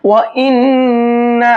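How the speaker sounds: وَإِنَّ said slowly with the doubled nun held in a nasal hum; ghunna on nun. Shown as a demonstration. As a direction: hold the نّ in وَإِنَّ with a long ghunna. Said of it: "نون پر تشدید ہے تو غنہ کریں گے". (وَإِنَّ میں نون کو غنہ کے ساتھ کھینچیں)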